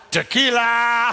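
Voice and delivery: wife's hammed-up party cry; mock shout. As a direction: belt it like the song. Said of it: "she just goes".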